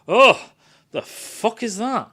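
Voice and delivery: shocked reaction. Retold or imitated. Crew reacting to the facehugger.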